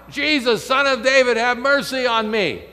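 loud pleading call; crying out. Retold or imitated. Bartimaeus calling to Jesus from the roadside, loud pleading. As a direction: call out for mercy at full voice. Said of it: "he's crying out".

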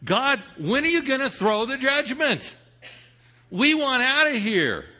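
high, impassioned plea to God; acted martyrs' cry, pitched well up. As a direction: cry out impatiently to heaven. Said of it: "the blood of the martyrs is crying out".

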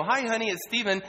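upbeat, happy to hear her; cheerful greeting. Retold or imitated. Cheerful call to wife.

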